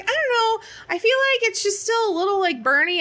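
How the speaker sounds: high, hesitant little complaint; mild mock whimper; unsure whine. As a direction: answer the doctor unsure and small. Unsure answer to doctor.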